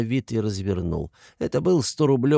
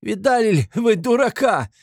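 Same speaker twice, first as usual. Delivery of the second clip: high, loud hysterical jeer; 13 semitones higher, 6 dB louder than usual. A narrator's reading. Katerina showing everyone the fool Luzhin, hysterical. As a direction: cry it out to the whole room. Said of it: "истерически захохотала".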